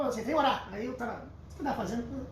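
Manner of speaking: ordinary delivery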